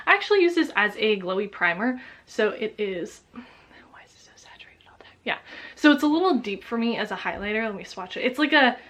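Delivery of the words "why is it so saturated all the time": hushed whispered question; whispered aside. Real, not acted. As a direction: mutter it under breath.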